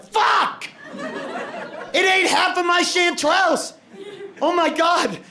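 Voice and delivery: mock furious rant. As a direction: rant like a man robbed by a mouse. Man finds mushrooms eaten, retold rant.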